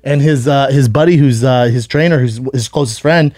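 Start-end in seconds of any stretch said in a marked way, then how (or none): none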